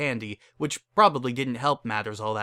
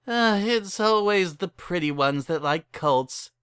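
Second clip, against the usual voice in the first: wistful; 7 semitones higher than usual. Acted. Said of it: "with a wistful sigh".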